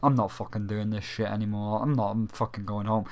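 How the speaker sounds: defeated mutter. Retold or imitated. Voicing the dad leaving the stage, low and soft. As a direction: mutter it like you've given up. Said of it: "It's like".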